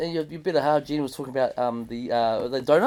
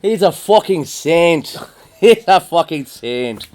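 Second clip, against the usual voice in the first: Irish echo; 4 semitones higher, 6 dB louder, slower than usual. Retold or imitated. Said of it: "repeating Jerry's line".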